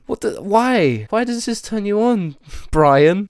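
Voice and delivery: high, slow, baffled why; incredulous at what he's reading; high-pitched disbelief. Real, not acted. Reacting to DM.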